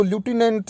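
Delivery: लेफ्टिनेंट said as lyoo-tee-nent; American pronunciation. Retold or imitated. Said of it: "American English में इसकी pronunciation". (अमेरिकी उच्चारण, ल्यूटिनेंट)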